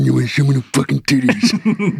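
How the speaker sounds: low creeper voice; sneaking-up come-on voice. Retold or imitated. Husband pesters wife whatever she's doing, low creepy voice. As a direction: put on a low, leering murmur. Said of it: "this exact voice, I go".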